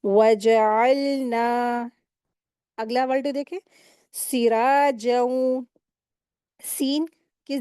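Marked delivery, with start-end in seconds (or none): 0.0-1.9 "वजअलना" very slow, still l, final "ना" stretched two counts; stretched recitation. Shown as a demonstration. Teacher reciting the whole word, slow measured recitation. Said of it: "दो हरकत खींचेंगे". 4.1-5.6 "सिराजऊ" slow, long "रा" and nasal hum on the final "ऊ"; ghunna recitation. Shown as a demonstration. Teacher reciting the next word, slow merged ghunna. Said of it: "इसको गुन्ना करके मिलाकर पढ़ेंगे".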